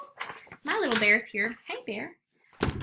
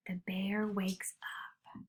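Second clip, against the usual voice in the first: whisper; 8 dB quieter, much slower than usual. A narrator's reading. Quiet suspense before waking.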